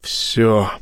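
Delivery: spent, resigned utterance; exhaled. A narrator's reading. Admiral slumped over broken console, exhaled in defeat. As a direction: breathe it out, all over. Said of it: "выдохнул он".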